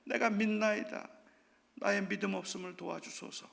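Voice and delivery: soft contrite prayer; quiet confession. Acted. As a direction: say it softly, as a humble confession. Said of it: "아버지가 했던 고백".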